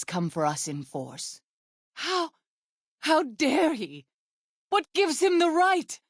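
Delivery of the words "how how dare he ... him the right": higher, hurt and furious at a friend's betrayal; indignant outrage. Acted. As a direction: burst out, how dare he. Betrayed by her friend Daniel.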